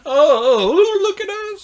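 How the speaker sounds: hammed-up teasing of a drunk man; mocking girls. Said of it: "they're, like, mocking the guy".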